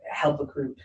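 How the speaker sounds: ordinary talk, nothing marked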